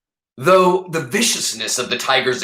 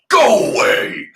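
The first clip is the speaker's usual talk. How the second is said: big roaring shout, louder and higher; thundering dragon; 12 semitones higher, 6 dB louder than usual. Acted. Dragon driving off the tiger, thundering shout. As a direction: roar it so even the girl trembles. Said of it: "Dragon thundered in a voice".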